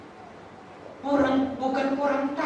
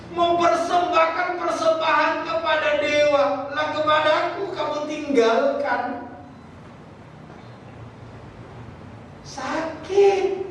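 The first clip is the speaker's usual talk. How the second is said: higher, pleading divine lament; a raised, aching voice of God; 6 semitones higher than usual. Retold or imitated. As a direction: speak as a wounded God. Preacher voices God's hurt.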